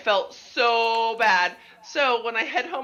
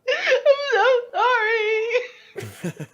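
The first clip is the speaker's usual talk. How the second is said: very high, slow, loud laughing sob; mock-sobbing sorry; retold bawling apology; 14 semitones higher, 4 dB louder, much slower than usual. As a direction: wail the apology through laughing tears. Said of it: "and I'm just bawling".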